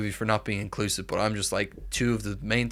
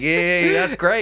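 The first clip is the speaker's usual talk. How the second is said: higher, louder sarcastic cheer; mock cheer; 7 semitones higher, 6 dB louder, much slower than usual. Imitating Irish people cheering an Irish Star Wars lad, mock cheer. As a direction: cheer it like a delighted fan. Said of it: "Irish people would be like".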